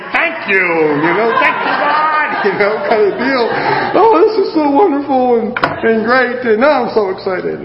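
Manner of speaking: over-the-top pretend excitement; mock gushing thanks. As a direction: gush like a thrilled kid. Mocks his own delighted thanks.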